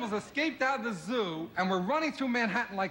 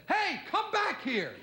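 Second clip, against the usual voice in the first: high, slow mock yell after a fleeing thief; mock call after thief; 12 semitones higher, slower than usual. Victim calling after the fleeing thief, mock high yell. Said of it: "this man said".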